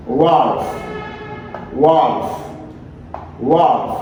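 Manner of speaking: ordinary talk, nothing marked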